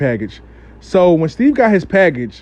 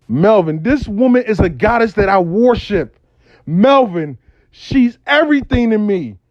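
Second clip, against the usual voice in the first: acted lovesick declaration, raised pitch; impassioned, near tears; 3 semitones higher than usual. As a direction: pour out devotion like a motivational speech. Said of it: "yo, you want me to cry".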